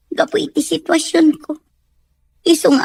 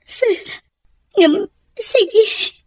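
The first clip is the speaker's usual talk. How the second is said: tearful; 4 semitones higher, much slower than usual. Acted. Maid tearfully accepts staying on.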